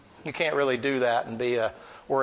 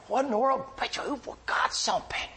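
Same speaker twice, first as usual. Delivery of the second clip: Herbie's voice; 11 semitones higher than usual. Retold or imitated.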